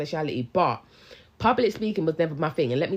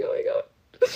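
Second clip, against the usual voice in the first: panicked gasp; slower than usual. Retold or imitated. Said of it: "I was like".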